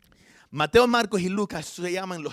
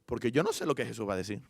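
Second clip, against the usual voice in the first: usual speaking voice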